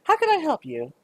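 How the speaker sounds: put-on high, sugary old-lady voice; a petite shopkeeper welcoming a customer in a high sweet voice; sweet little shopkeeper. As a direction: greet them like a doting granny. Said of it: "a pretty little petite woman that goes".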